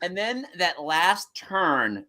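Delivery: ordinary delivery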